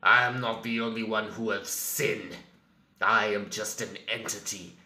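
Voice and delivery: normal talking voice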